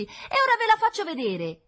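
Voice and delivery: put-on very high, loud, defiant child voice; little girl shouting to be believed; tearful shouting. A narrator's reading. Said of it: "continuò a gridare tra i singhiozzi". (grido acuto di sfida infantile)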